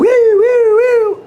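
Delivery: high siren wail on woo woo; high playful siren whoop; imitated alarm siren. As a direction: mimic a door alarm going off. Imitating an alarm that didn't sound.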